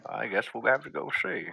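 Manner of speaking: a quiet hint of an old father; older gentleman. Father answering his child, lightly voiced older gentleman. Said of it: "what sounds like an older gentleman".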